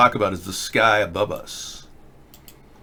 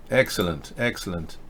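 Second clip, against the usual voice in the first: much lower and much softer repeat; hushed echo; 7 semitones lower, 9 dB quieter than usual. Acted. Host repeating praise to himself, hushed. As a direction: drop it to a murmured afterthought.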